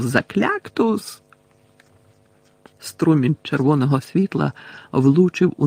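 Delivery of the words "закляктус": high, drawn-out incantation; called-out spell. A narrator's reading. Harry casting from under the table, high drawn-out call. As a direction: stretch the spell on a high pitch. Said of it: "і вигукнув".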